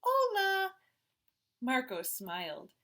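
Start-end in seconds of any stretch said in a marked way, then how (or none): 0.0-0.7 much higher, louder Hola; kids trying Spanish. A narrator's reading. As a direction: echo the new word eagerly. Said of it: "the twins said".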